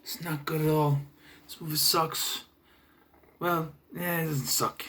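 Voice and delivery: ordinary talk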